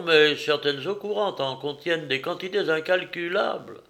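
usual speaking voice

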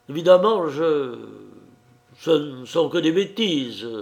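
stammering, words dragging and breaking off; hesitant, halting. Acted. Shy young man stumbling through his request to Liuba. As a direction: fumble for each word, repeating je, je. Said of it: "reprit en hésitant, en traînant les mots".

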